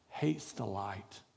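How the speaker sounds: preacher lowering to a slow, hushed warning; dramatic hush. Real, not acted. Dramatic sermon line.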